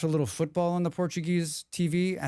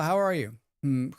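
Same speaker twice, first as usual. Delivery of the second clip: normal talking voice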